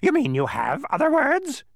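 high, loud, gleeful squawk; delighted bird screech; happy bird. A narrator's reading. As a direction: cry out happily as a screechy bird. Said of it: "cried the bird happily".

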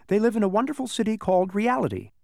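high-pitched, excited announcement; Alec announcing. A narrator's reading. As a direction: proclaim it high and proud. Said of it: "he announced".

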